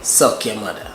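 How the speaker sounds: relaxed native Jamaican delivery; native Jamaican model. Shown as a demonstration. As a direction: say it the way a Jamaican would. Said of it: "Say it for me, baby".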